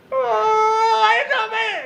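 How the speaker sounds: high desperate wail; panicked cry. Retold or imitated. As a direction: cry out caught between orders. Himself panicked.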